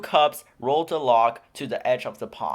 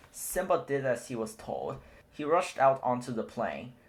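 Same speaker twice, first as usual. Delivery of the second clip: ordinary delivery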